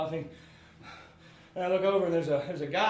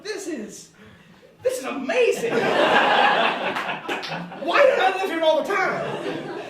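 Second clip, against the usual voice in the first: delighted laughing; 12 semitones higher, 10 dB louder, slower than usual. Retold or imitated. Delighted quote at naked waitress.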